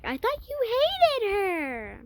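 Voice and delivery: high, slow, stretched-out disbelief; shocked accusation. Retold or imitated. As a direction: pitch it up high in mock outrage. Confronting the friend, shocked.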